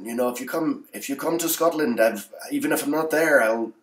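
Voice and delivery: high-pitched, bad Scottish impression; hammed-up Scottish lilt, pitched well up; terrible Scottish accent. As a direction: imitate a Scottish merch seller, badly. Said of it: "That was a terrible Scottish accent".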